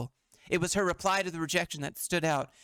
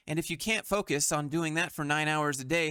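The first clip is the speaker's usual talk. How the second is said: usual speaking voice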